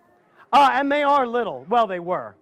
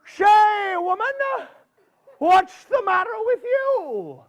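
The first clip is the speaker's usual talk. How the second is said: put-on high, pitched-up critter voice calling out to the old woman; a folksy groundhog greeting her, pitched up high; Mister Groundhog's voice; 10 semitones higher than usual. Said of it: "Mister Groundhog saw her coming and he says".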